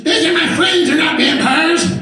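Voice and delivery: high, loud put-on teen girl; indignant teen daughter. Daughter scolding her little brother, loud indignant teen. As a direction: snap back like an offended teenager.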